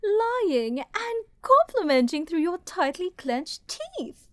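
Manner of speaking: gloating taunt. Acted. Villain taunts while laughing.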